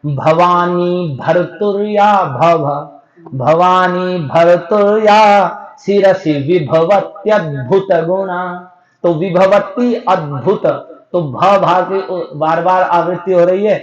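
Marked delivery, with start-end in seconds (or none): 0.1-8.4 slow, every भ in "Bhavani भर्तुर्या भव" sounded out; repeated bha sound. Shown as a demonstration. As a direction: dwell on each भ of "विभवत्यद्भुत" one by one. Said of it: "चार भकार हैं".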